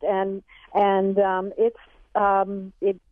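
ordinary delivery

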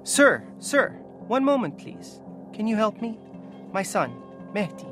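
high, urgent plea; pleading mother. Acted. Mother stopping an official about her son, pleading. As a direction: beg a passing official in a high voice.

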